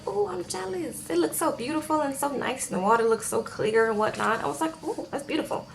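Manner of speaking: dreamy jealousy. Retold or imitated. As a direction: swoon softly over the view. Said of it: "And I was like".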